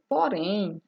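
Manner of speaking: ordinary delivery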